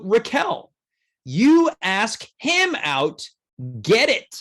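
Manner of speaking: slow, spaced-out read of a viewer's urging; slow and deliberate, with pauses; emphatic message reading. Acted. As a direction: spell it out phrase by phrase. Reads a chat message out emphatically.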